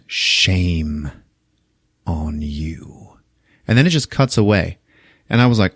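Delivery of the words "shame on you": low, slow, fading to a whisper; whispered reproach. Retold or imitated. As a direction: breathe the shame onto her, barely voiced.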